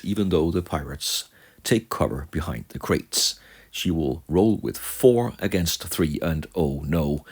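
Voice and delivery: ordinary talk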